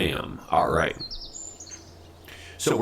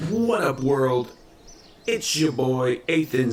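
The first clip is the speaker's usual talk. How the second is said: excited; 6 semitones higher than usual. Acted. Vlog intro greeting.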